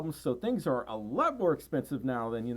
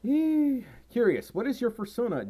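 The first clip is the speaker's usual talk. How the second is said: much higher, playful squeak on "curious"; squeaky voice; 8 semitones higher, 4 dB louder than usual. Acted. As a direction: pipe up high and curious. Reading chat, voice jumps.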